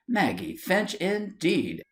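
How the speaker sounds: high indignant exclamation; exclaimed. A narrator's reading. Wife hearing another girl's name, exclaiming indignantly. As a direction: Maggie Finch, indeed, flung out in shock. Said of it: "exclaimed Eliza".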